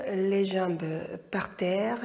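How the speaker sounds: normal talking voice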